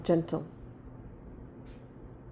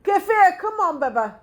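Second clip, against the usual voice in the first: calling out; 5 semitones higher, 6 dB louder than usual. Real, not acted. Calling running child.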